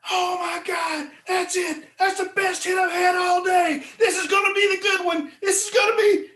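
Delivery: high, loud, thrilled shouting; excited fishing shout. Retold or imitated. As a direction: yell it like the biggest bite of your life. Jay hooks the fish of his life.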